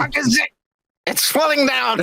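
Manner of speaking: high-pitched, imitated frantic instructions; mock film-set direction. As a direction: call out like a panicking director, in jest. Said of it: "you're gonna be like".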